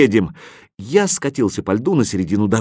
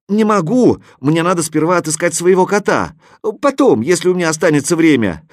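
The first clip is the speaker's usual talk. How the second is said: shouting back; 6 semitones higher, 4 dB louder than usual. A narrator's reading. Hero shouts back up the mountain.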